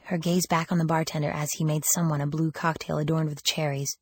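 plain reading voice

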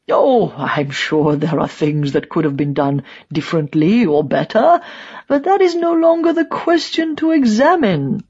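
character voice, conceding then sharply dismissive; dismissive old true believer. A narrator's reading. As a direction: brush it off like an imperious old die-hard.

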